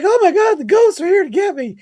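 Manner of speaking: high, loud squeal of panic mimicking a scared coworker; scared friend impression. Mocking a coworker who fled the ghost room, high frightened squeal. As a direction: shriek it like a grown man terrified of ghosts. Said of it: "I don't know why he talked like that".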